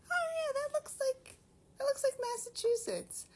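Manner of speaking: very high, cracking squeal of recognition; squealed realization. Retold or imitated. Vlogger recalling spotting Massachusetts, high squealing surprise. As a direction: squeak it out as if spotting home onscreen. Retold recognizing own film.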